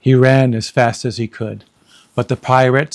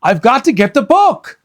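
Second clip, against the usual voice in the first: calling out; 11 semitones higher, 4 dB louder than usual. Acted. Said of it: "Jack called".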